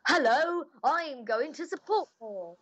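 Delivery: hammed-up projection to the back of the audience; projected stage voice. Shown as a demonstration. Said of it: "you're not really gonna be like".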